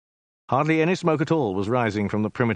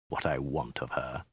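low, much softer hiss; 8 semitones lower, 9 dB quieter than usual. A narrator's reading. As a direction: hiss the threat quietly. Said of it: "he hissed".

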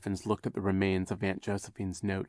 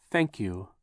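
ordinary delivery, nothing marked

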